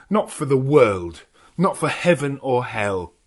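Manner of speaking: loud, heated objection; rising anger at the professor's plan; hot protest. Acted. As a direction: Jonathan furiously refusing. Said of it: "interrupted him hotly".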